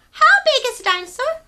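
high, loud curious little penguin voice; a tiny penguin full of questions. Acted. Story reader voicing small penguin Pipkin asking big questions. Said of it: "said Pipkin".

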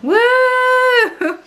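high loud sarcastic woo; cheering. Real, not acted. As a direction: cheer it out, mock celebration. Celebrating day three of lockdown.

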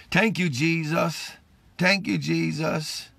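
low, slow, soft murmured thanks; a softly spoken thank you to Jesus; hushed thanks. Real, not acted. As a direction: drop right down and breathe out thanks. Quiet thanks in prayer.

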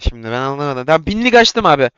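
ordinary talk, nothing marked

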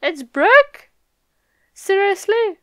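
high, loud, incredulous burst; amazed disbelief. Real, not acted. Reader spots Brook in the chapter, loud high surprise.

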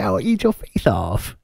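lightly hammed-up face-eating threat; mock Tyson threat. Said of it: "was like".

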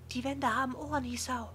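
put-on high, friendly librarian girl. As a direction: play a bright young girl. Yuko voiced by narrator.

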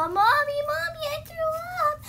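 put-on high, whining little kid; whiny kid voice. Shown as a demonstration. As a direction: whine to mommy about throwing up. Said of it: "he's gonna go".

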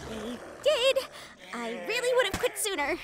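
ordinary talk

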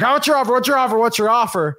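high, loud mock New Yorker demanding; mocking a pushy New Yorker seller, loud and high. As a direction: hammer the question like an impatient New Yorker. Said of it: "they're like".

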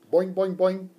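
high, loud alarmed boings; boing in shock; shocked frog boing. Acted. Frog Og shocked at the rude kids, loud boings. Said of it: "Og was as shocked".

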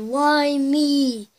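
normal talking voice

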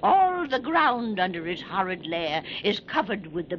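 put-on spooky old keeper spinning a tale; creepy old sea keeper. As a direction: relish every grisly detail. Old keeper tells horror tale.

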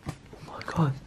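breathless frightened whisper; whispered oh my god in the dark; scared whisper. Real, not acted. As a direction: hush it out, afraid to be heard. Creepy sound in dark room.

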